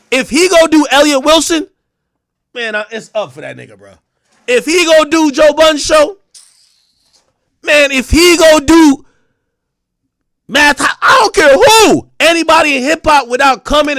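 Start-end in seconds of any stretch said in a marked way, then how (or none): 4.5-14.0 shouting, louder and higher, drawn out; heated rant. Real, not acted. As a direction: rail at him at full volume. Heated rant about [name] interviews.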